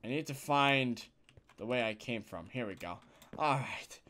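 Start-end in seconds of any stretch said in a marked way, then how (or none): 3.4-4.0 whispering. Real, not acted. Quiet aside while playing.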